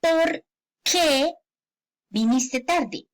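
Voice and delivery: POR... QUÉ, two words far apart; separated words. Shown as a demonstration. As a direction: split "por qué" and pause before "viniste tarde". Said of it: "Es la pregunta".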